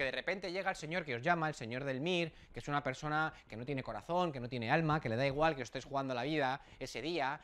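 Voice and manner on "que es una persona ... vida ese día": ordinary delivery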